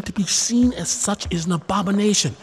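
high, scornful wife; wife's high, indignant scolding. Acted. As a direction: shame him for his sackcloth.

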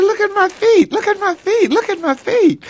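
high, tearful, pleading; retold tearful cry. Crying to his dad about his feet. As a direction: cry it out in wonder. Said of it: "And I'm crying".

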